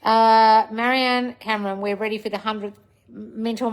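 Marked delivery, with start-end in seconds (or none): none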